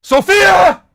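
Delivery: high, full-throated shout for a family member; real calling-out yell with a long held ending; yell upstairs. Real, not acted. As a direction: holler the name up through the house. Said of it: "That's why I yell up to my family".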